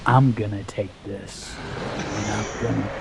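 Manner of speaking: put-on low, soft, menacing old woman's voice; ominous old lady. Strange old lady threatening him in the store, low and ominous. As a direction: hush it into a creepy threat. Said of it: "she was so ominous with everything".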